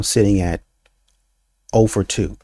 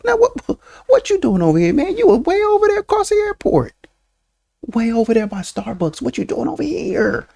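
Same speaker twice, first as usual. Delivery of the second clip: imitating a loud stranger, voice pitched way up; hammed-up high, hollering chatter; loud talking; 11 semitones higher than usual. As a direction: holler across the airport like an oblivious loudmouth. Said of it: "talking loud".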